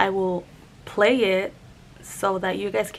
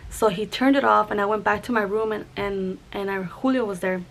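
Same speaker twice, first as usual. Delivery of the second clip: ordinary talk, nothing marked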